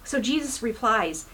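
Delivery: ordinary delivery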